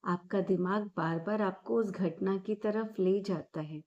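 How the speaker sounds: calm, unhurried meditation guide with long pauses; measured, soothing guidance, drawing out the breathing cues; guided meditation. Acted. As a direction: guide them gently inward, phrase by phrase.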